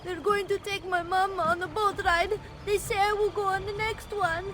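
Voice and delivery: very high, tearful little voice; tearful child. Acted. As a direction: tell it as an upset small child. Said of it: "why do you cry?".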